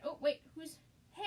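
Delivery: normal talking voice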